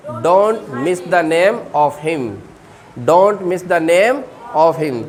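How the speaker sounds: very slow, spaced-out words; slow teaching demo. Shown as a demonstration. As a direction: spell it out slowly for learners. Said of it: "क्या बोलेंगे?".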